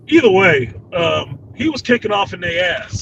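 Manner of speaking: ordinary talk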